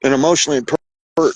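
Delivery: ordinary talk, nothing marked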